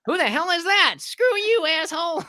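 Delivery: high, loud, indignant kid impression; mock an outraged preteen yelling back; twelve-year-old gamers. Kids answering the adult newcomer, high-pitched mock outrage. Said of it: "it's just a bunch of twelve-year-olds".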